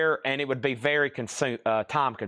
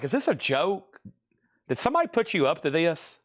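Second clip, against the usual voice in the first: high-pitched retold disbelief; mock-baffled question, pitched up; incredulous; 3 semitones higher than usual. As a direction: ask it as if you suspect a prank. Retelling disbelief at waitress's confusion.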